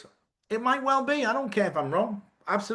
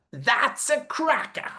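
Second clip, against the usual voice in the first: enthusiastic; 12 semitones higher, much slower than usual. Real, not acted. Enthusiastic drawn-out praise.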